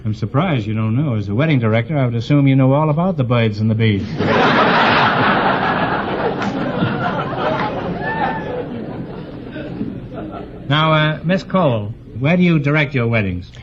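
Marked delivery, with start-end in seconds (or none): 4.1-10.6 audience laughter. Real, not acted.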